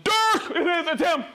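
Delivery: high, excited cry of recognition; overjoyed welcome back; thrilled greeting. Real, not acted. Regular viewer returns, host thrilled.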